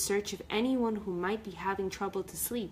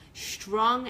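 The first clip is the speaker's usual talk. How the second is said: ordinary delivery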